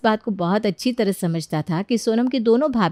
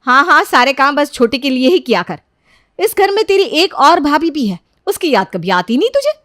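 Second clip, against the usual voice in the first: loud, higher-pitched sarcastic complaint; reproachful taunt; 6 semitones higher, 7 dB louder than usual. Acted. Elder sister-in-law accusing Sonam of favouring the younger, loud reproach. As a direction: needle her with a loud grievance.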